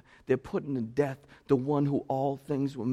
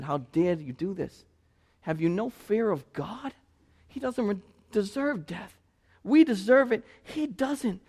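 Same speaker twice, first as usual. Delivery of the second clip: rebuke; 7 semitones higher than usual. Retold or imitated. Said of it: "He rebuked the other criminal and said".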